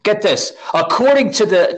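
usual speaking voice

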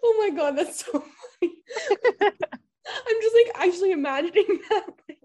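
high-pitched words through real laughter; cracking up. Real, not acted. Friend hearing about tipsy mom's British accent, cracking up. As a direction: laugh helplessly while talking.

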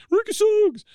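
very high, loud fan yell; crowd yell. Retold or imitated. A fan calling from the crowd, in a high yell. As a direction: shout it from the bleachers. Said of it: "a yell from the crowd".

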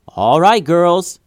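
loud, high rallying shout; yell. A narrator's reading. Tatiana rallies Ava and Atya while running, loud yell. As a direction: holler it to the team. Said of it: "she yelled".

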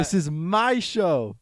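slow, drawn-out playful claim; mock indignant. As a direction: stretch it out like a proud owner. Repeats claim slowly.

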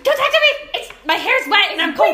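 high, loud, laughing grump; grumpy teen. Pretend. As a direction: whine it through laughter.

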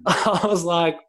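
ordinary delivery

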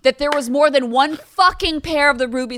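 loud, emphatic tirade; exasperated rant. Real, not acted. Podcaster ranting about Wizard of Oz slippers, exasperated. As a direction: belt out the point, fed up. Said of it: "you scare me when you drink".

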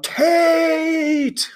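high, drawn-out fan's holler; excited cheer. Retold or imitated. Fan reading his match notes, long high cheer. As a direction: call the name out long and high.

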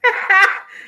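sudden high-pitched burst of laughter. Real, not acted. Host cracking up at a viewer's comment, high laugh. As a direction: crack up in quick high peals.

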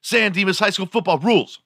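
very high, excited dude yell; Bill and Ted movie shout. Retold or imitated. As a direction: whoop it out like a teen movie dude. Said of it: "Remember Bill and Ted's Excellent Adventure?".

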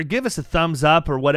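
normal talking voice

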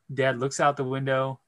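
ordinary talk

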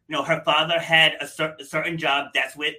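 normal talking voice

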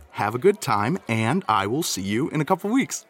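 ordinary talk, nothing marked

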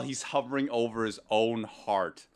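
ordinary talk, nothing marked

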